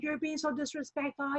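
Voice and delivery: high, hushed mock yell; mock screaming. Retelling the director scolding her for disrespect, mock scream. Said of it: "he was screaming at me".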